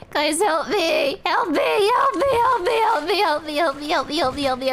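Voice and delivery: hammed-up lost-in-the-maze panic; mock panicked cries. Streamer lost in a game, begging chat for help, mock panic.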